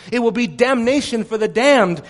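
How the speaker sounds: ordinary delivery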